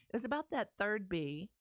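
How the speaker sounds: usual speaking voice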